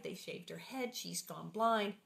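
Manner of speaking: usual speaking voice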